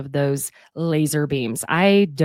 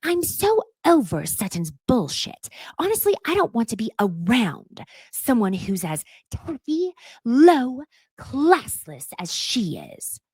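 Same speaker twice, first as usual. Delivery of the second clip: higher, slow put-on voice for Dorit; Dorit's confessional rant; 6 semitones higher than usual. In confessional, mocking Dorit's disgust with Sutton in a higher voice. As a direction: sneer it out in disgust, pitched up. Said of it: "she says then in confessional".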